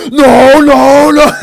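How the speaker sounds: real giddy outburst at the screen; excited protest. Real, not acted. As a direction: fangirl shrieking at the screen.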